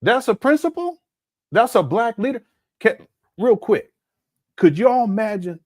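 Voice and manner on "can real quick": ordinary talk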